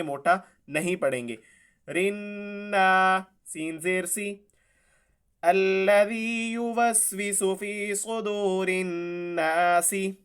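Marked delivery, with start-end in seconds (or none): 1.9-3.2 रिन्ना with a light thin र, slow. Shown as a demonstration. Teacher showing thin ra under zer, slow. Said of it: "रॉ को हम बारीक कर देंगे". 5.4-10.1 slow chanted tajweed; Quran recitation. Shown as a demonstration. Teacher reciting the verse for students. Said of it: "recites the verse".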